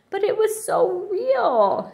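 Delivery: put-on high, slow childlike protest; acted upset that is starting to settle; calming down. A narrator's reading. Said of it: "she said, calming down a bit".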